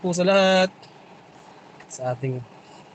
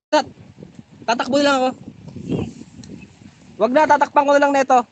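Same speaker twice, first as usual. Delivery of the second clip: excited; 8 semitones higher, 3 dB louder than usual. Real, not acted. About to run in the rain.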